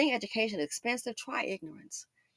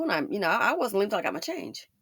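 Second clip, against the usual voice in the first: ordinary talk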